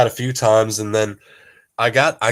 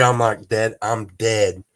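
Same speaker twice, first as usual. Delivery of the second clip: normal talking voice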